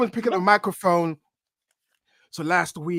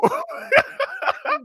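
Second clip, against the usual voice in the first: high-pitched burst of laughter; laughing. Real, not acted. Guest cracks up at fumbled wrestler name, laughing. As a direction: crack up helplessly.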